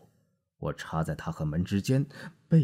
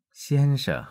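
sarcastic; 9 semitones higher, 8 dB louder than usual. A narrator's reading. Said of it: "挖苦的微微鞠躬".

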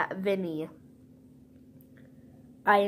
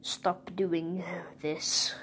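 ordinary talk, nothing marked

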